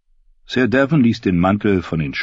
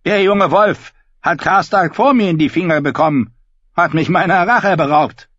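high, loud bleating sheep voice; 11 semitones higher, 5 dB louder than usual. Acted. Davin voiced by narrator.

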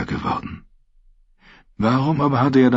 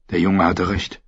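plain reading voice

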